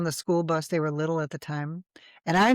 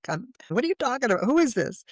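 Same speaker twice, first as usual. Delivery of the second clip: retold bewilderment; 7 semitones higher, faster than usual. Retold arguing with the air.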